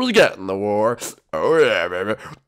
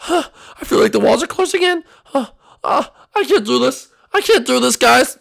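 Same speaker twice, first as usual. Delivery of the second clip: high, gasping distress; acted overwhelmed panic, pitched up; panic attack; 12 semitones higher, 6 dB louder than usual. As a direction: wail as if the walls close in. Panic attack, walls closing in.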